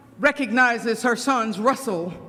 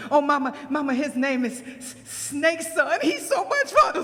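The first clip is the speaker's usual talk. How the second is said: put-on high giggly child voice, climbing to a squeal; excited child; 4 semitones higher than usual. Frog tells Mama about his new friend, excitedly. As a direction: gush about your new friend, laughing.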